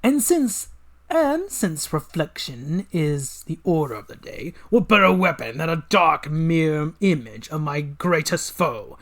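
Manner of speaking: gloating evil genius, slow and grand; villain voice. Acted. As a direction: savour every word like a mad scientist. Villain unveils weapon.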